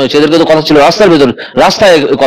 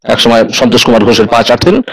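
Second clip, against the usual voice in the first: ordinary talk, nothing marked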